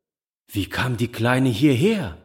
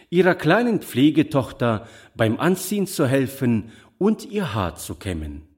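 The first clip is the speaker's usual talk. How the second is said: ordinary delivery, nothing marked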